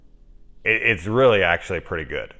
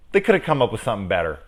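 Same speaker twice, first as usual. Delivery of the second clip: normal talking voice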